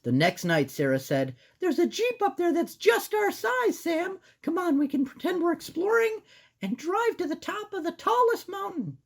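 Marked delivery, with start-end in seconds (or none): 1.6-9.0 put-on squeaky girl mouse; high-pitched cartoon mouse. Voicing Sarah.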